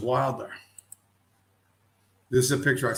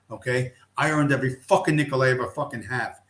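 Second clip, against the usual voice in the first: normal talking voice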